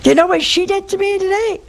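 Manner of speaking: high outraged complaint; outraged husband. Retold or imitated. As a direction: squeal it like a wronged husband. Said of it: "he told me".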